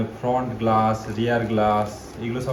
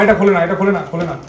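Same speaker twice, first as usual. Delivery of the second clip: loud, raised-pitch repeated warning; urgent calling out; 5 semitones higher, 8 dB louder, faster than usual. Real, not acted. Father stopping child opening the product, urgent and loud.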